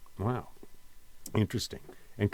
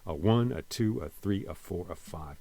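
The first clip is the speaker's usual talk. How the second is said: "A one, a two, a three, a four, a five" with numbers stressed; steady da-DUM count, unstressed "A" before each number; counted iambic beat; 4 dB quieter than usual. Shown as a demonstration. Teacher repeating the pentameter count, weight on each number. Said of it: "remember for iam-, for iambic pentameter".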